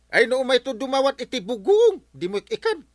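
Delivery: loud and high, huffy; indignant outburst. Acted. Captain complaining to councilor, loud indignation. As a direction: raise your voice in offended protest.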